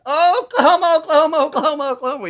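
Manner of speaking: high, loud frantic yelling; shrieking the name over and over; Dirty Rotten Scoundrels impersonation. Retold or imitated. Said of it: "the scene from Dirty Rotten Scoundrels".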